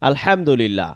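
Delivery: normal talking voice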